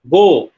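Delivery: "go" with a gliding "oh-oo" vowel, said loud; diphthong. Shown as a demonstration. Lecturer giving one example, "go" as a loud diphthong. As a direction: glide the vowel of "go" towards "oo". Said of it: "British English or American English is basically diphthong".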